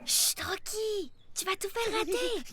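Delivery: soft, slow shushing; quiet urgent hush; hushed warning. Acted. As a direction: hush him so they aren't caught. Hushing Rocky while hiding.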